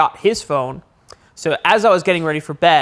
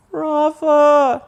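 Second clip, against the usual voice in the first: much higher, calling out a name; 11 semitones higher than usual. Retold or imitated. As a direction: call through the door. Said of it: "and then I hear".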